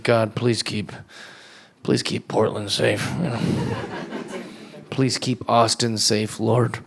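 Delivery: hushed, low mock prayer to God; a murmured prayer, half-joking. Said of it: "I pray for those towns".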